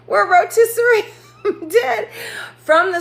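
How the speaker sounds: high, laughing through the words; cracking up. Real, not acted. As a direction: lose it laughing mid-sentence. Cracking up at own joke.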